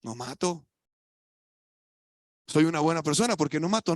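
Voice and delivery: lightly mocking a self-righteous churchgoer; smug self-justifying. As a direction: play the smug good person. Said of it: "¿Mm?".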